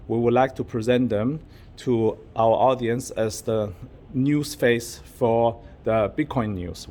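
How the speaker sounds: normal talking voice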